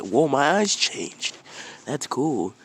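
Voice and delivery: high, loud, drawn-out wonder; acted wide-eyed thrill; amazed excitement. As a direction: marvel at it like an excited kid. Boy sees his changed eyes.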